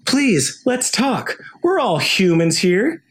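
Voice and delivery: high-pitched pleading plea, slowing down at the end; squeaky nervous peacemaker; pleading Elliot. Retold or imitated. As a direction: beg the goblins for peace in a high voice. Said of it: "he goes".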